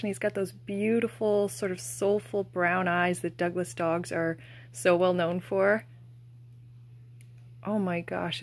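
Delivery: ordinary delivery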